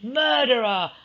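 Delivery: high, loud furious scream; Alice raging at Edward, screaming; screamed in rage. Acted. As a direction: scream the accusation. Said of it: "flew into a passionate rage and screamed".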